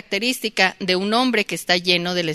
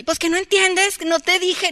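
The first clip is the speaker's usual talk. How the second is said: yelling at the boss; 11 semitones higher than usual. Pretend. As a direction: play yelling at your boss, overdone on purpose. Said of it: "nunca le voy a gritar así".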